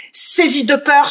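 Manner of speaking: usual speaking voice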